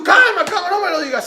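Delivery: high, loud flustered plea; hammed-up panicking bureaucrat; panicky official. (funcionario asustado, agudo y fuerte)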